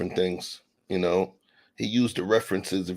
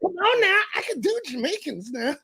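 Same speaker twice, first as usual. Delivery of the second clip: mocking friend. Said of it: "He's like".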